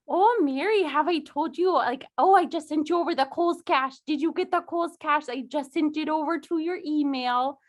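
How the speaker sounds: put-on chatty mom with a Midwestern accent. Actor recreating a cut film line to Mary, Midwestern mom accent. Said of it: "Midwestern mom".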